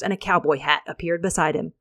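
plain reading voice

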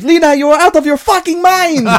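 very high, hammed-up shriek; mock woman's scream. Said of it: "Then a woman screams".

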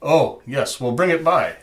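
put-on high, loud Russian accent; an eager Russian tradesman with a Russian accent. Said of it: "call up my Russian accent".

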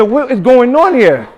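high, loud, slow cry of disbelief; loud stunned question; retold bewilderment. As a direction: shout it out, totally lost. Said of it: "He said he's excited".

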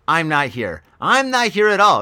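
normal talking voice

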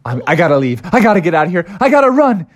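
building frightened frog; panicking frog. Acted. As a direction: rush it out, ready to flee. Froggy panicking at the door.

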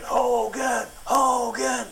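high, shouted chant of a fan crowd; crowd screaming. Retold or imitated. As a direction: yell the name like a mob of fans.

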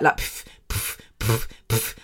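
soft breathy puffing noises; oxygen puffs. Retold or imitated. Podcaster imitating oxygen hissing up her nose. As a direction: puff air like an oxygen tube. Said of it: "oxygen going up your nose".

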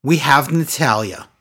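ordinary delivery

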